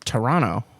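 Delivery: "Toronto" in three syllables; full pronunciation. Shown as a demonstration. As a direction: say "Toronto" as tuh-RON-oh. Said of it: "I would like to say it".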